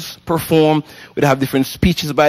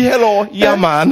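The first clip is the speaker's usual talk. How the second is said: playful Jamaican accent, raised pitch; 5 semitones higher, slower than usual. Shown as a demonstration. Guest joking that his Irish comes out Jamaican, playful Jamaican accent. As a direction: lean into a Jamaican lilt, higher. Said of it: "it's gonna be Jamaican".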